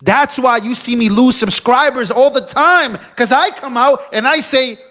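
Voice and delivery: high, heated declaration; worked-up, emphatic venting; defiant rant. Real, not acted. Defiant rant about lost subscribers.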